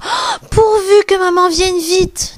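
put-on high, fearful voice of the littlest kid; scared little one; trembling with fear. A narrator's reading. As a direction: wish it anxiously in a small high voice. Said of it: "tremblent de peur".

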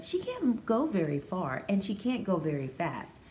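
ordinary delivery

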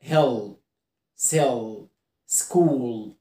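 هيل، سيل، سكول with heavy final l; low recap list, each l held back; dark L. Shown as a demonstration. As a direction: end هيل، سيل، سكول on a thick "ull". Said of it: "والال دارك زي كلمة".

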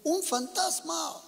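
high, slow, frightened cry of a ghost sighting. A narrator's reading. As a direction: cry it out in terror, drawn out. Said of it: "dieron voces de miedo". (grito asustado, agudo y lento)

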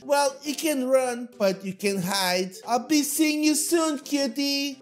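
high, sing-song predator playing with prey; playful, creepy girl voice; teasing stalker girl. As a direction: taunt them sweetly as they flee. Plays the pursuing girl.

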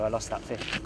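ordinary talk, nothing marked

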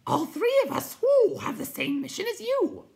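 very high, loud hooting voice; hooting creature. A narrator's reading. Night Hob replies to the newcomer, loud high hoot.

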